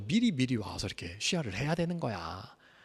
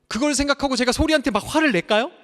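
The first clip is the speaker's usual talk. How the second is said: fast, louder, higher pulpit challenge; fiery preaching; 11 semitones higher, 9 dB louder than usual. Acted. Pastor challenging the congregation, fiery preaching. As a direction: hurl the question at the congregation. Preacher rising to full force.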